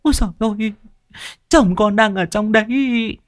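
put-on high, whining old woman's wail; whimpering wail. A narrator's reading. Mrs Xuân wails for her husband in the room, high and whimpering. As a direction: wail in a high voice, near tears. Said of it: "Mụ mếu máo".

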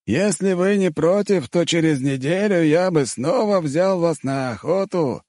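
high, drawn-out character voice; slow, high-pitched, courteous invitation; Mongol character, politely formal. A narrator's reading. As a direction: stretch the words out, high and slow.

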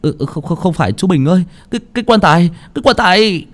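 high, breathless whimpering stammer; tearful, panting stammer. Acted. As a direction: stammer out an excuse, winded and near tears. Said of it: "Một anh mếu máo".

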